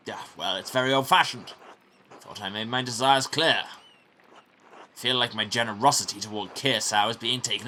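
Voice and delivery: a lord who feels cheated; displeased baron. Acted. Baron scolding prior over unfinished book, haughty.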